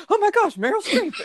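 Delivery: very high, loud gushing squeal; hammed-up starstruck shriek; imitating starstruck fan, beside herself. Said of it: "so beside herself".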